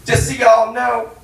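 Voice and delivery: loud, high ranting suicide note; mock furious rant. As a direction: tear into Tom in accounting. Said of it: "I'd be like".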